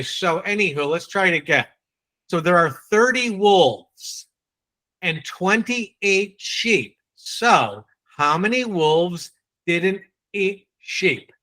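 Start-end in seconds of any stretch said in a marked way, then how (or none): 2.3-11.3 slow, careful, word by word; meticulous diction. Shown as a demonstration. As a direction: spell out each number slowly so nobody mishears. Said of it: "I was meticulous in my diction".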